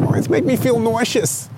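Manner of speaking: high, fretful neurotic New Yorker; Woody Allen. Pretend. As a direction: whine it like an anxious comic. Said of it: "Woody Allen kind of".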